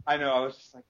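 usual speaking voice

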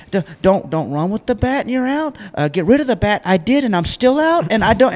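high-pitched mock meltdown of a five-year-old; child's nervous breakdown. Storyteller as his five-year-old self protesting being out, mock meltdown. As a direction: imitate an overwhelmed kid protesting, pitch climbing high. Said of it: "I remember I had a nervous breakdown".

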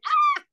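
a sudden, very high and loud shriek; high startled shriek. Retold or imitated. Guest reliving the actor's fake chase, sudden high shriek. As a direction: yelp like you just got jumped.

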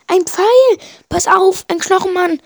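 very high, loud alarmed cry; alarmed shout. A narrator's reading. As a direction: yell the warning as an arrow hits. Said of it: "ruft Primo".

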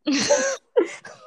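loud high burst of laughter. Real, not acted. Bursts out laughing at own joke.

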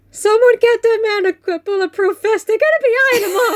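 high, urgent mock panic; mock alarm. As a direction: raise the alarm, pitched high and half laughing. Acting out alarmed reaction to speech.

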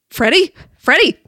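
high gasp, then a loud cry of his name; startled, frightened call; gasping awake. Acted. Waking from nightmare.

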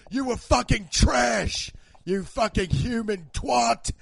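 loud, slow, high British shout; hammed-up kitchen tirade; Gordon screaming. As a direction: spit abuse like a furious chef. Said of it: "He screams".

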